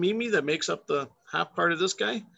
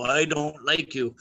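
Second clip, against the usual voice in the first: grumpy bird; slower than usual. Pretend. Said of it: "These guys go".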